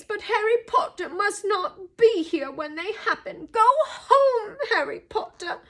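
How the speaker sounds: high, wailing elf warning; urgent house-elf. A narrator's reading. Dobby urging Harry to go home, urgent and high. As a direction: warn him in a high, pleading creature voice.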